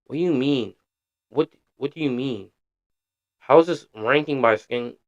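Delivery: usual speaking voice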